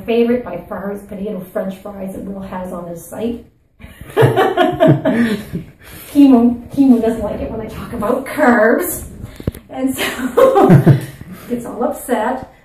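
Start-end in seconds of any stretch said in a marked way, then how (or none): 3.8-6.1 loud burst of real laughter. Real, not acted. Host teasing about carbs, loud laughter. 6.1-12.5 talking through real laughter; loud, amused, breaking into laughs; laughing. Real, not acted. Host teasing about carbs, laughing as she talks.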